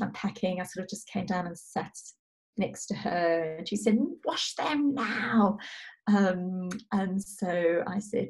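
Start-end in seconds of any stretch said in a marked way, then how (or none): 4.2-5.6 high, very drawn-out mock whine; imitated demanding child. Mother voicing upset daughter wanting hands washed, demanding whine. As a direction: stretch each word like an insistent small child.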